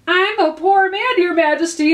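high, loud pleading quaver; trembling voice. A narrator's reading. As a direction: beg with a trembling voice. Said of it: "in a trembling voice".

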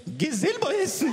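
put-on high defiant child's whine; mock high whiny kid. Child snaps back at mother calling him to eat, high whine. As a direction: sulk it back in a squeaky pitch.

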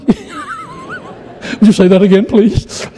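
squeaky high laughing mock challenge. Preacher retelling his dare to the trucker, laughing and squeaky. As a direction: laugh through a cheeky dare.

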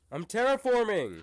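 high, loud exasperated insistence; insistent protest. Real, not acted. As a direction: raise your voice to insist. Insists after being mocked.

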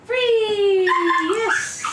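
loud bright release cue; excited release call. Real, not acted. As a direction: call it out happily. Releasing dog from stay.

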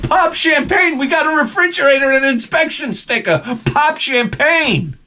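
very high, whooping celebration; jubilant shout. Retold or imitated. Driver celebrates refrigerator and inspection sticker, jubilant high shout. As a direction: shout it like a huge win.

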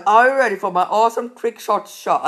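ordinary talk, nothing marked

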